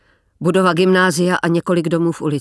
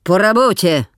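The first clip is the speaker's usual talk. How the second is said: Russian officer. Acted. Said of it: "řekl N K V D k vojákům".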